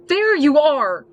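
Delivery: high, loud angry snap; snapped. Acted. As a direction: bark it out, furious. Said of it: "she snapped".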